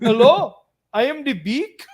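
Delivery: mock Indian accent. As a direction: put on a hammy Indian accent. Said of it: "make it like Indian".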